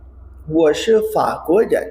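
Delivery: 我是中国人 said very slowly, syllable by syllable; slow drill reading. Shown as a demonstration. Teacher reads Li Wen's line slowly for learners. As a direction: spell out 我是中国人 at a crawl. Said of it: "मेरे साथ बोलिएगा".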